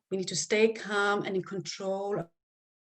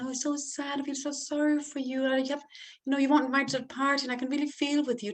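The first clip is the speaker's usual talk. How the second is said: gushing sympathy; 4 semitones higher, faster than usual. Shown as a demonstration. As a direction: pour on pity from above, too sweet. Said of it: "and not sympathy".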